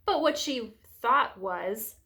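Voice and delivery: ordinary delivery, nothing marked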